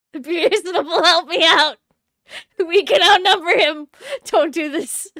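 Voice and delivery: laughing, loud acted plea; high-energy comic begging; loud pleading. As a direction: beg for help at full voice, half laughing.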